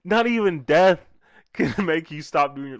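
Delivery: emphatic cry breaking into a laugh; laughing disbelief. Real, not acted. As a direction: holler it through laughter. Loud disbelief while laughing.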